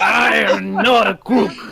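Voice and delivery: slow, loud, jowly Nixon voice; Richard Nixon impression. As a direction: deliver like Nixon denying. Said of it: "It sounds like Richard Nixon".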